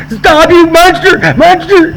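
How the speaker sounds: high, slow drawn-out mock yell; hammed-up yelling at the Munster. Yelling at high friend hiding in bathroom, mock yell.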